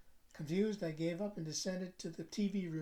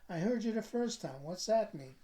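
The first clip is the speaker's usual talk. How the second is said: irritated; 5 semitones higher, 6 dB louder than usual. A narrator's reading. Irritated at repeated riddle.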